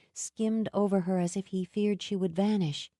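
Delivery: plain reading voice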